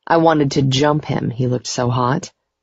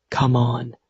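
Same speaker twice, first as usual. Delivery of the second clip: low male voice; 3 semitones lower, 4 dB quieter than usual. A narrator's reading. Gideon leads her away.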